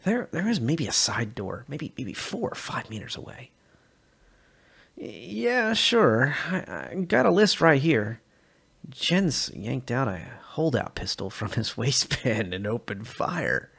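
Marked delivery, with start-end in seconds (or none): 4.9-8.2 nervous stammer. Acted. Jens stalls nervously.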